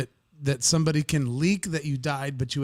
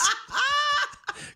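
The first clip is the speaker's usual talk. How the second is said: breaking up in a high-pitched giggle; high squealing laugh. Real, not acted. Cracking up at own crush confession, squealing laugh.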